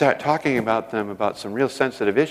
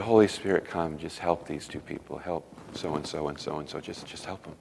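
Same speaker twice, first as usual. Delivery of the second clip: softly voiced hushed prayer, intimate; tender prayer; 6 semitones lower, 10 dB quieter than usual. Preacher praying softly over the crying couple, hushed. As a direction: pray gently over the weeping couple.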